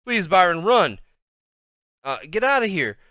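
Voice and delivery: put-on alarmed kid urging; urgent plea. A narrator's reading. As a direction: beg brother to flee. Kid begging brother to run.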